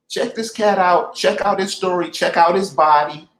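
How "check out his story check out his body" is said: ordinary delivery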